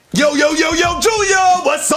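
high, excited hail to a friend; exuberant greeting. Acted. Rapper greets Junior on returning, exuberant. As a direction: call it out high and drawn-out.

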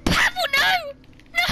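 high, loud horrified shout; mock-tragic scream of no; anguished shout. Real, not acted. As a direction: yell in despair at the horse. Horse dies again.

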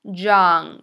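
put-on loud, low YOUNG, falling; model pronunciation. Shown as a demonstration. Teacher asks class to repeat, loud model pronunciation. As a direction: push YOUNG out loud for learners to copy. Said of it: "Repeat after me".

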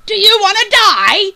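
very high, full-force scream. A narrator's reading. Captain frightens the crew into leaving, screaming. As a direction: scream it at the crowd. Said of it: "screamed the captain".

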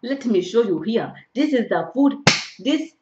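put-on loud, animated African-accented presentation. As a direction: show off the food in a hearty African accent. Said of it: "doing an African accent".

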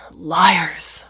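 loud, angry outburst; screamed accusation. A narrator's reading. Father in bed raging at the TV news, angry yell. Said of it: "her father screamed".

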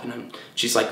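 ordinary talk

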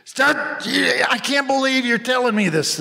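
high, pleading mock outrage; Don Rickles. Rickles pleading with a fan, high and pained. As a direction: cry out like he can't bear to hear it. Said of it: "And, and I said".

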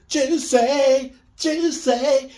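high, loud, sung-out JUICY; drawn-out high hype voice; talking bag of seafood. Acted. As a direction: call it out like food tempting you. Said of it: "and it just says".

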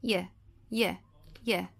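"Y" as a short "yuh", not the letter name; isolated Y sound. Shown as a demonstration. Teacher drills the letter's sound, short "yuh" for "Y". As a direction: give "Y" as a brief separate glide sound. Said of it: "som da letra Y".

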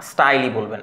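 normal talking voice

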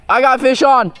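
high, loud excited call; fighting a fish. Real, not acted. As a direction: shout it out mid-fight. Hooks a fish live.

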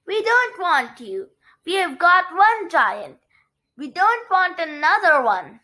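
indignant villagers calling out; yelling. A narrator's reading. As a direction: push them away with firm, high protest. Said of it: "yelled the people".

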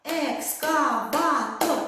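Ек-ска-ва-тор, slow, each syllable split apart for a clap; Екскаватор broken into four clear claps; clapped syllable by syllable. Shown as a demonstration. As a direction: spell out Екскаватор slowly in four beats. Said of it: "Чотири оплески".

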